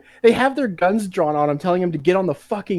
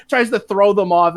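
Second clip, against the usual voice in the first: normal talking voice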